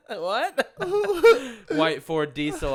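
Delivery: laughing through the words; giggling while reading. Real, not acted.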